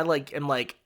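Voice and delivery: ordinary talk, nothing marked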